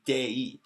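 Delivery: "day" with the ee ending brought out; exposed E glide. Shown as a demonstration. Teacher exposing the hidden sound, day ending in ee. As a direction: widen the end of "day" into ee. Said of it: "this weird E sound at the end".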